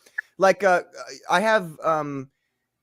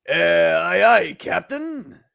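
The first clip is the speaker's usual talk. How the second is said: slow dopey henchman; 5 dB louder, much slower than usual. Acted. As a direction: drawl it like a dim sidekick. Henchman answers captain.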